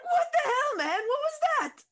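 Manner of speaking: high startled yelp; shocked and loud; startled outburst. Acted. Character startled, calling out.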